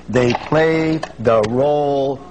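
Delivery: slow, suspenseful stretch of each word; drawn out. Real, not acted. Seminar speaker leading audience to the key word, drawn out. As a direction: draw it out and make them wait.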